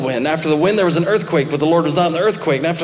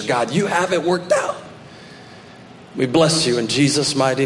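hushed prayer; 5 semitones lower, 4 dB quieter than usual. Acted. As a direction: pray it quietly over the congregation. Closing prayer.

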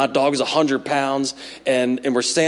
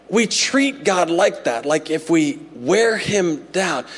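usual speaking voice

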